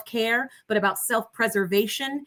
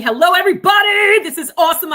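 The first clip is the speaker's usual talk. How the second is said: loud, high, bright shout of hello; excited hello; 8 semitones higher, 9 dB louder than usual. Real, not acted. Guest greeting the online audience, excited shout. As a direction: burst in with a big greeting.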